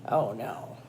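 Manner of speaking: usual speaking voice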